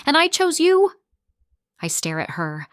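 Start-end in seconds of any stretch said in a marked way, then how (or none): 0.0-0.9 high, loud anguished wail; a full wailing cry, high and loud. A narrator's reading. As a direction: howl it out in despair. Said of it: "she wails".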